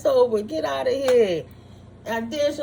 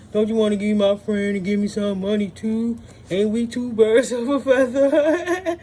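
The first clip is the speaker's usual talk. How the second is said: a lower, sly, wheedling voice, sucking up for money; mocking the schemer; 4 semitones lower than usual. Imitating the double agent angling for money, sly and fake-sweet. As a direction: play the two-faced op who fakes being nice to get paid. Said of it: "because you feeling in your heart".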